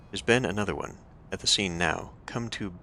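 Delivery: ordinary talk, nothing marked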